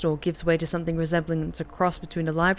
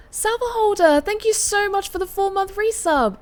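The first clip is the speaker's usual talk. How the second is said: bright, high, upbeat thanks; excited; 13 semitones higher, 4 dB louder than usual. Real, not acted. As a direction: gush with delight.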